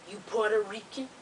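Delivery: low, hushed imitation of the man; strange man's questioning. Strange man questions her in the waiting room, low imitation. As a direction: imitate him in a low, softened voice.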